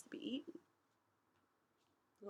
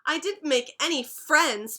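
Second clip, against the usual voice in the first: cried; 3 semitones higher, 6 dB louder than usual. A narrator's reading. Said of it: "Penelope cried".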